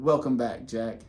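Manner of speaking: usual speaking voice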